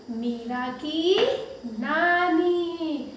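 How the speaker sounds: मीरा की नानी drawn out slowly; reading a lesson title to kids; slow sing-song title. Shown as a demonstration. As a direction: stretch नानी. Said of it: "बड़ी की मात्रा है ना".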